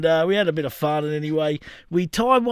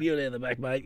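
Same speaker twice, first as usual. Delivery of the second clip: lower, much softer aside; hushed retelling; 7 semitones lower, 11 dB quieter than usual. Retold or imitated. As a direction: quietly settle a drunk mate for the night. Retold late-night settling of drunk guest.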